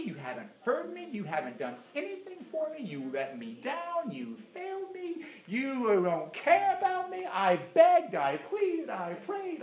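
high, whiny mock complaint; sulking complaint. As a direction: play the sulking believer. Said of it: "Joshua does".

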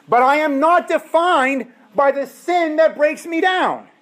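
high, loud declaration that sin no longer defines him; triumphant preacher's cry; loud proclamation. Real, not acted. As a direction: proclaim it to the back row.

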